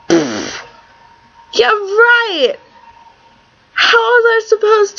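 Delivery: scoffing, sarcastic high yeah right. Real, not acted. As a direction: scoff it off in disbelief. Scoffing at drinking advice.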